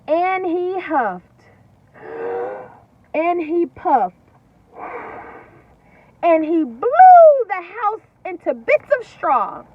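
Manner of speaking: loud, slow, building narration; dramatic storytelling. A narrator's reading. Teacher reading to children, loud and slow. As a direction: tell it big for the kids.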